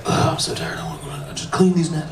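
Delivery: fast whispered whine; whispered complaint. Acted. Peter whining to himself about the nets, whispered. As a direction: mutter it fast under your breath.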